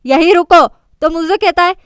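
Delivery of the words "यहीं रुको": eyes closed command. A narrator's reading. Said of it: "बंद आंखों से कहता है".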